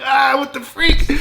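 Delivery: high, drawn-out mock shriek; scared shriek. Friends seeing the creepy painting, retold scared shriek. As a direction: re-enact a startled scream, slow and high-pitched. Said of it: "we all kinda get scared".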